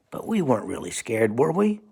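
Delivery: usual speaking voice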